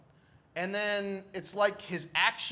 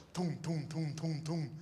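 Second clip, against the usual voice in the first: imitated passing lights; 6 dB quieter than usual. Patient wakes to lights passing overhead, rhythmic imitated toom. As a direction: pulse out soft, regular booming noises. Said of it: "I just see these lights go".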